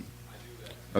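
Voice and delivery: real whisper, off to the side; whispered aside. Real, not acted. Poet whispering an aside between poems, real whisper. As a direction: murmur it just for yourself.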